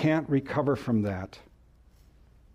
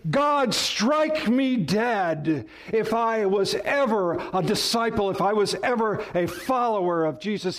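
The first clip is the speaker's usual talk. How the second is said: loud, emphatic proclamation; 6 semitones higher than usual. Acted. Peter cursing himself before all who would hear, emphatic. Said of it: "He was proclaiming as loudly, as emphatically as he could".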